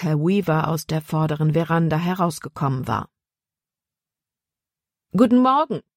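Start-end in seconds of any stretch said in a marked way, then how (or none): 5.1-5.8 high, raised-voice greeting; calling out. A narrator's reading. Caleb greets Herr Weaver from a distance, called out high. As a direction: call it across the yard, pitched up. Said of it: "rief Caleb ihm zu".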